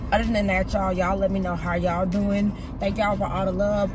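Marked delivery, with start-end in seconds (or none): none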